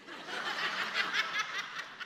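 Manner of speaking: soft high chuckle; laugh. Real, not acted. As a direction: laugh quietly, high and airy. Amused by his own story.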